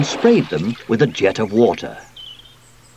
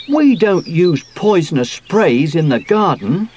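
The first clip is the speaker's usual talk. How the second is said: higher, insistent objection; protesting; 7 semitones higher, 4 dB louder than usual. A narrator's reading. Sooty tells Sweep off about poisonous sprays, protesting. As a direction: object firmly in a raised pitch. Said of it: "Sooty protested".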